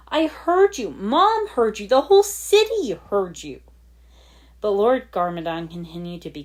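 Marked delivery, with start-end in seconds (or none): 0.1-3.6 much higher and louder, stressing each heard; biting. Acted. Lloyd accusing his father, rising angry shout. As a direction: build the accusation line by line. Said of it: "he said bitingly".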